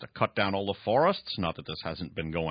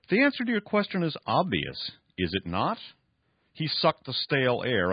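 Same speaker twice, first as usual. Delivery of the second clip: raised, emphatic story reading; dramatic novel reading; 8 semitones higher, 3 dB louder than usual. Acted. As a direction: read the villain's boast with drama. Reads novel passage aloud.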